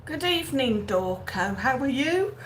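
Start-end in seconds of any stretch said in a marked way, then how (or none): 1.6-2.4 words stretched by a yawn. Real, not acted. Host greeting Dorco while yawning. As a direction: ask it mid-yawn. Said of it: "yawning me head off".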